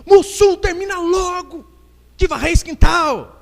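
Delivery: high, loud, drawn-out order; berro da esposa. Acted. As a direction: holler at your husband like a bossy wife. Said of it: "a mulher dele dá um berro". (berro agudo de esposa mandona)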